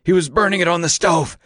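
high-pitched rasping report; raspy. A narrator's reading. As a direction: rasp out the explanation. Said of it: "rasped Grossman".

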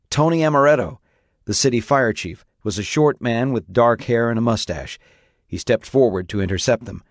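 ordinary talk

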